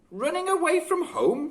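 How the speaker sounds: high, questioning disbelief; surprised. A narrator's reading. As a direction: echo the boy in surprise. Mrs Cole surprised reply.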